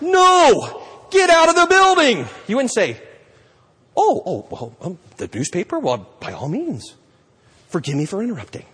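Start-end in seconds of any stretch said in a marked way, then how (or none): 0.0-2.3 loud, high, firm order; insistent shout. Pretend. Insisting a resident leave fire. 4.0-8.6 mock meek politeness. Mock meek apology to resident.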